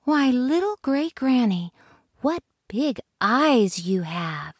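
a hint of a curious little girl; lightly voiced Little Red. Little Red to wolf, lightly voiced girl.

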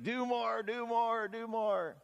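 hammed-up driver urging the flock; mock slave driver. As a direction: drive them on like cracking a whip. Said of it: "I need a whip and a chair".

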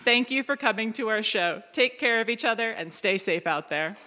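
normal talking voice

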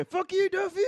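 high squeaky cartoon shout. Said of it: "Oh, yeah".

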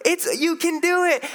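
high, mock coaxing voice; wife's hammed-up encouragement, high; wife urging. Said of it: "And she's like".

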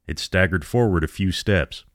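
ordinary delivery

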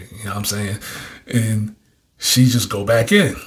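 ordinary talk, nothing marked

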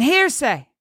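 high accusing call; farmer's cry. A narrator's reading. Farmer getting the townsfolk's attention, high cry. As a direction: call it out high to rouse a crowd. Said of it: "It was the farmer's cries of".

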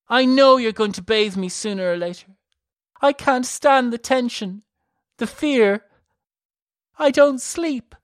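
high, loud sobbing plea; tearful fear. A narrator's reading. Elisa weeping to her sister about the cage, tearful plea.

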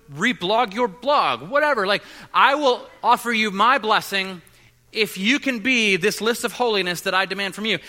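loud, high scolding as the conservative side; hammed-up demanding preacher voice; mock yelling. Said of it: "always yelling at you".

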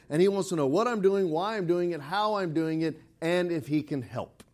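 ordinary talk

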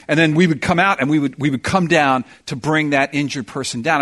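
ordinary delivery

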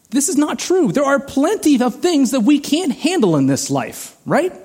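high-pitched, insistent protest; impassioned preacher's objection; emphatic rebuttal. Real, not acted. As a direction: push back hard, pitch way up. Preacher heatedly rejects the saying.

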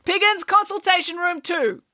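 a tired doctor calling the next patient; bored doctor. A narrator's reading. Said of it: "in a bored voice".

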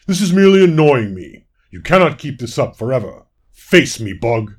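loud, annoyed demand; frustrated growl. A narrator's reading. Fawn lord demands the elusive butterfly face him, loud and frustrated. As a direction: growl a loud challenge at a pest. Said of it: "He growled in frustration".

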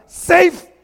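high, loud baseball call of safe; shouted safe. Retold or imitated. Juanito calling out as he walks in late. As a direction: yell it like sliding into base. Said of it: "le hace el Juanito cuando entra".

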